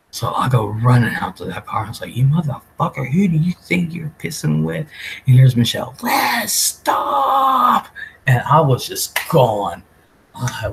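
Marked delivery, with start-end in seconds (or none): 2.2-4.9 retold challenge, a bit higher and rough-edged; angry confrontation. Retold confrontation with sister's boyfriend. 6.0-7.9 high, sharp yell to stop a friend; shouted plea. Retold or imitated. Friend yelling to stop her.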